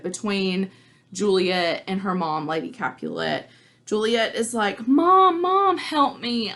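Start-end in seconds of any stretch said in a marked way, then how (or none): 4.9-6.5 pleading daughter. Retold or imitated. Juliet begs her mother.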